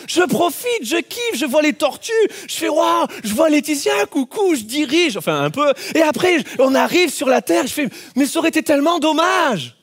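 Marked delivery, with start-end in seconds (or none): 2.7-5.2 higher, thrilled calling, slowing on the end; excited calling out. Retold or imitated. Man in the air calling out to his wife, thrilled. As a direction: wave and shout for joy. Joyfully calling out while flying.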